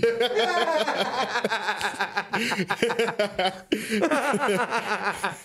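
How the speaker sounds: high-pitched laughing fit; sustained real laughter. Real, not acted. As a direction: keep cracking up, unable to stop. Cracking up at joke nickname.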